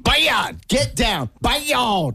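high, drawn-out scolding of the dog. Pretend. Owner scolding a dog off the counter, high drawn-out calls. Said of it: "pretend like he just jumped onto the counter".

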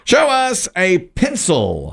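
high, slow call to the board; suspenseful host's reveal; game show reveal call. Real, not acted. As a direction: draw out each word, falling on the answer. Game show host calls for the board.

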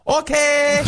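very high, mock Chinese-accented yell. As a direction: parrot her accent in a high squeal. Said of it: "in a mocking accent way".

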